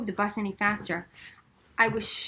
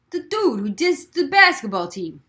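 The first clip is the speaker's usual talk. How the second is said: put-on louder offhand boy; boy's voice; 4 semitones higher, 9 dB louder than usual. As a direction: toss it off like a bored kid. Boy's companion answers.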